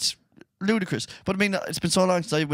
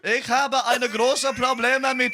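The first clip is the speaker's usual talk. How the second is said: mock German accent; 9 semitones higher, slower than usual. Mock German after accent request.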